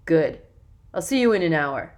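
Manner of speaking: put-on loud, self-important young man; bossy older boy. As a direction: lecture the new kids, puffed up. Jackson dismisses boys.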